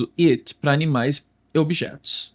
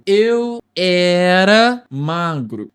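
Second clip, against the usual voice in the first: arrastando cada sílaba, alto; bem devagar; 4 dB louder, much slower than usual. Shown as a demonstration. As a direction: fala lenta e marcada de exemplo. Exemplo lento em português. (português bem pausado)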